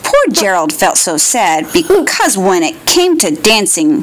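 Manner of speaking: ordinary delivery, nothing marked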